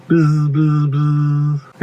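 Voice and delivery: repeated long monotone buzzes; imitated buzzing. Reader imitates insects coming past, droning buzz. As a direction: drone the z like an insect.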